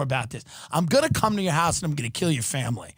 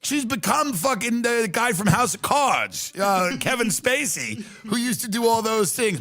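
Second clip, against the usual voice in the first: ordinary talk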